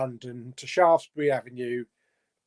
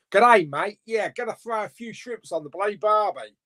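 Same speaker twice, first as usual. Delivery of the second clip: higher, hammed-up Aussie accent; Australian accent; 5 semitones higher than usual. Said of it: "That's my Australian one".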